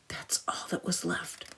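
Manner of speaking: soft, hushed storyteller whisper. A narrator's reading. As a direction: breathe it out like a secret. Story whisper after Poof.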